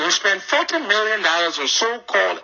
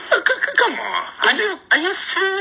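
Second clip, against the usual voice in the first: stammering, pitch leaping much higher; can't believe what he just heard; stunned high-pitched come on; incredulous disbelief. Real, not acted. Host reacts to guest's claim.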